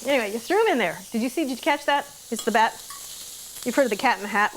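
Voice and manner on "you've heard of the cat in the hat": ordinary talk, nothing marked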